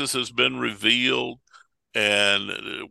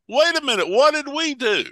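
high-pitched, baffled mock protest; acted-out startled objection; protesting money changers; 12 semitones higher, 4 dB louder than usual. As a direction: object as a wronged trader, pitched up. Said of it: "they have the same response".

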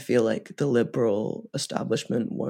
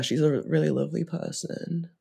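ordinary talk, nothing marked

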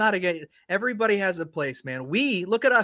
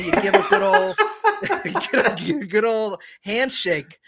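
laughing through quote; 4 semitones higher, 4 dB louder than usual. Retold or imitated. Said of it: "that scene in Mallrats where he's like".

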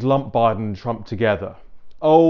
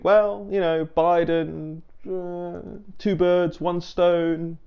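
higher, sly scheming voice; mock schemer; 6 semitones higher, much slower than usual. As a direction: voice the plotters, pleased with themselves. Voicing deep state thinking.

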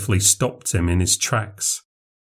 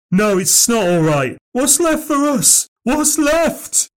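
far higher, slow, despairing cry; anguished lament; 13 semitones higher, slower than usual. A narrator's reading. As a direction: plead it as if everything's lost. Frank lamenting the town's loss.

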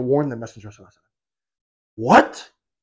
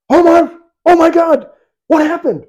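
high, loud panicked cry; alarmed husband yelping the name; freaking out; 12 semitones higher, 7 dB louder than usual. Retold or imitated. Husband hearing Umar came by, panicking high and loud. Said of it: "He's freaking out".

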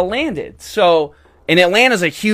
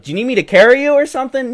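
offering to carry her; 10 semitones higher, 4 dB louder, faster than usual. Retold or imitated. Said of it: "So I was like".

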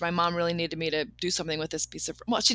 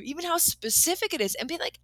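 ordinary delivery

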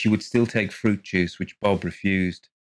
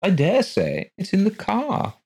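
pettish; 12 semitones higher than usual. A narrator's reading. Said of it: "he replied pettishly".

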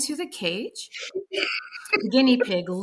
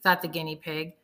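ordinary delivery

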